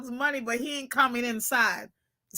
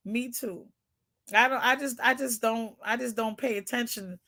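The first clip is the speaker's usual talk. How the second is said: normal talking voice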